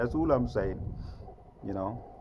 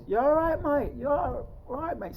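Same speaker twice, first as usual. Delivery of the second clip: high-pitched imitation of an Irishman; strong Irish accent; 6 dB louder than usual. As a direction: put on a high Irish lilt in greeting. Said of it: "He had that Irish strong accent".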